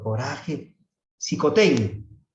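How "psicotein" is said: loud, slow, high command with a fall; palabra de Jesús. Acted. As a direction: call it out, drawn out and commanding. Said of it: "Jesús solamente dice una palabra". (orden proclamada, fuerte y alargada)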